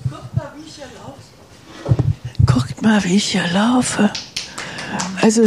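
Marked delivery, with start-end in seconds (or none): 0.1-1.2 faint and distant, much softer, a little quick; called out from across the room; far from the mic. Real, not acted. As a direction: heard from far off, well away from the microphone. Calls out before reaching mic.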